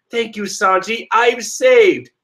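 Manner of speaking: grateful sister. Retold or imitated. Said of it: "Reiju just going".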